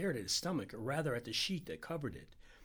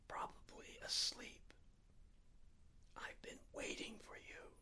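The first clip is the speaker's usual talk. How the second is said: slower, softer whisper, fading away; 5 semitones higher, 8 dB quieter than usual. A narrator's reading. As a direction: barely breathe the words out. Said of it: "so softly I had to lean close".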